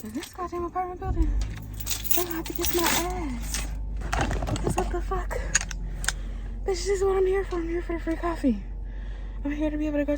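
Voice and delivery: high, fast, outraged ranting; high-pitched rant. Real, not acted. Ranting about broken coffee machine.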